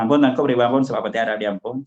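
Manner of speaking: hurried, offhand run-through; quick, sloppy recitation; rushed careless plea. Shown as a demonstration. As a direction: gabble the words off carelessly. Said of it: "Adakah hamba rakyat datang".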